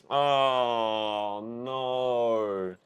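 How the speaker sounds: low, drawn-out lament; sorrowful, sinking dismay; dismayed groan. Real, not acted. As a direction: let it sink, long and low. Dismay at character's death.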